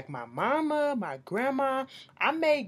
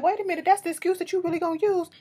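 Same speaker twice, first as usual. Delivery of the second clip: high-pitched, rushed incredulous challenge; mock disbelief; 9 semitones higher, faster than usual. Challenging Kim's drinking excuse, incredulous. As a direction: call out a flimsy excuse, pitched way up.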